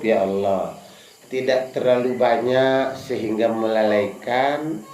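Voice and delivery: low, slow and hushed, no shouting; soft humble prayer. Shown as a demonstration. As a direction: pray it gently, as if in your heart. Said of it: "Ikut dalam hati saja, nggak usah teriak-teriak".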